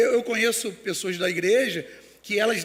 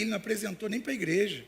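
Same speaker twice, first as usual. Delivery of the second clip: ordinary talk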